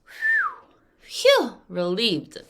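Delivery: high squeaky phew then relieved sigh. Retold or imitated. Teacher showing relief, high phew. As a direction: blow out relief. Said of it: "So you're like".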